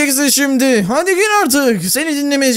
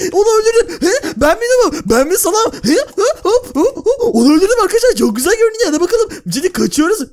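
giddy gloating; 6 semitones higher, faster than usual. Acted. After killing guard, gloating.

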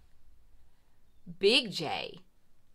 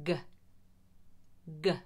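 normal talking voice

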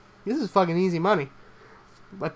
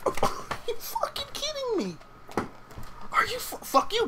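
squealing rage; 5 dB quieter than usual. Real, not acted. Losing a duel, raging at opponent.

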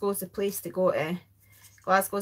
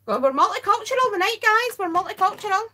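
excited; 11 semitones higher, 6 dB louder than usual. Real, not acted. Excited about the international viewers.

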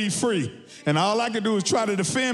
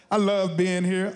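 ordinary talk

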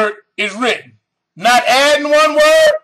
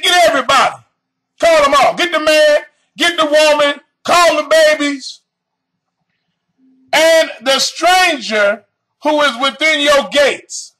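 preaching at full force; 4 semitones higher than usual. Acted. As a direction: call out the whole congregation. Preacher voicing God's call.

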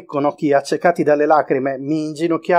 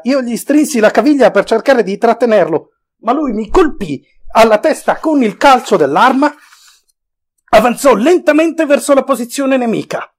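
usual speaking voice

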